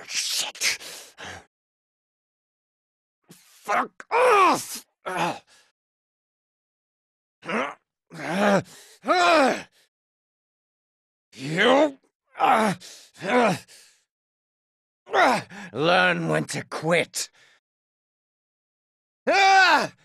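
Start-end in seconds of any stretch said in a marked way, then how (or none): 3.3-4.8 high shouted curse; angry struggle. Acted. Fighter struggling against attacker. 7.5-9.6 rising high hurt grunts; pained cries. Acted. Fighter hurt. 19.3-19.9 loud, high battle cry; attack yell. Acted. Fighter attacks.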